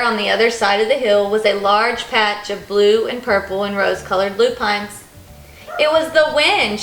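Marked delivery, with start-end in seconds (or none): 5.8-6.8 delighted. A narrator's reading. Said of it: "as she knelt in delight".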